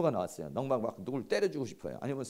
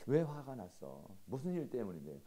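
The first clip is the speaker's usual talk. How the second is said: gentle concern; 4 semitones lower, 8 dB quieter than usual. Acted. Husband asks angry wife what happened, gentle and soft. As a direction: coax out the reason softly. Models calm reply to anger.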